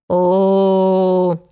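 long, level "oh" of mock sympathy; drawn-out sigh. A narrator's reading. All the boys sigh over the empty beds, drawn-out sighing. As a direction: sigh one long held "oh". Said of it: "вздохнули все".

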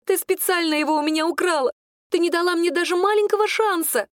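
high, wounded outcry; tearful accusation. A narrator's reading. Claudine says her chance was stolen, high and tearful. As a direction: wail the reproach, without real sobs. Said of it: "Она зарыдала в голос".

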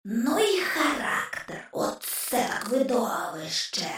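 soft, slow, sneering; a ghost's hushed mockery, drawn out; mocking ghost voice. A narrator's reading. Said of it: "насмішкуватий шепіт". (тихий повільний глузливий голос привида)

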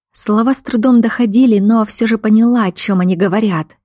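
usual speaking voice

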